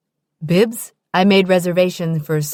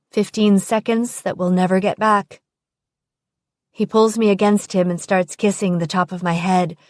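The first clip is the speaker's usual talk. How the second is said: plain reading voice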